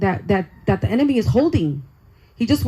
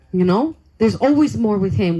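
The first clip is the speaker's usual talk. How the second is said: usual speaking voice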